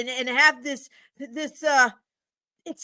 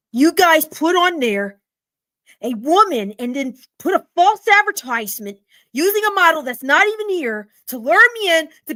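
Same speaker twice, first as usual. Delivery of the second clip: furious rant; 5 dB louder than usual. Acted. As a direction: tear into them for lying. Customer rants at false ad.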